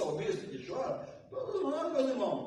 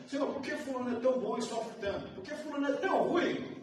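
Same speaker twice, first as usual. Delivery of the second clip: complaining believer's prayer; 7 semitones higher, 3 dB louder than usual. Retold or imitated. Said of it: "A gente pergunta".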